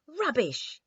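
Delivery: loud, high outburst; cried out. A narrator's reading. The king loses his temper at Eyebright, loud cry. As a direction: burst out, forgetting manners. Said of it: "he cried".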